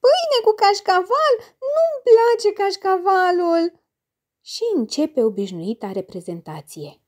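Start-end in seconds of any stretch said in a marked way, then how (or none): 0.0-3.8 put-on high, slow whiny girl; girl sulking over the cheese; fussy child. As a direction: complain like a picky little girl. Child complains about dinner.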